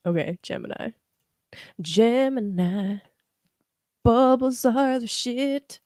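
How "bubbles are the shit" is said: enthusiastic. Real, not acted. Enthusiastic slow declaration.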